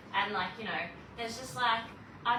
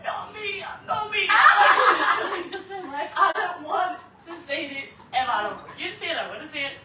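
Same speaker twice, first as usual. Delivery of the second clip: laughing mock protest; 8 semitones higher, 12 dB louder than usual. Mum laughingly retells her own protest.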